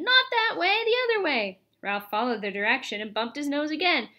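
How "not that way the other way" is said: put-on high, urgent kids' cries; shrieked correction. A narrator's reading. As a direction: call out directions in a high voice. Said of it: "shrieked the children".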